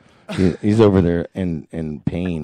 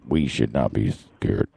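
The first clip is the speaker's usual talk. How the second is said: low, flat robotic speech voice; a poor imitation of a speech computer; Stephen Hawking voice; 13 semitones lower, 4 dB quieter than usual. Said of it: "do it in the Stephen Hawking's voice".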